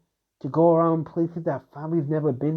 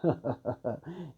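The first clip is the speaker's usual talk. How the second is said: soft villain chuckle; 9 dB quieter than usual. Acted. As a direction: chuckle softly at her threat. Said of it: "the figure would just actually just chuckle a little".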